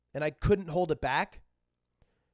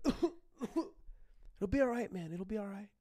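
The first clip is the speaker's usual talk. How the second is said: slow, soft, higher-pitched comforting; nice-kid reassurance; 3 semitones higher, 7 dB quieter, slower than usual. Retold or imitated. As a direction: soothe gently like a kind schoolmate. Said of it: "He was the nicest guy ever".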